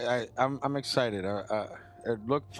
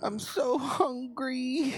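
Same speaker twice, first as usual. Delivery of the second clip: high, drawn-out wail; put-on plaintive, stretched-out character voice; Slither chick; 12 semitones higher, much slower than usual. Playing the Slither chick from the movie, a high wailing impression. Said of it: "he's gonna be like the Slither chick".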